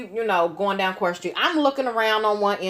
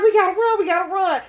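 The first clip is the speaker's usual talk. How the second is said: urgent friend; 8 semitones higher, faster than usual. Retold or imitated. Friend urging flight.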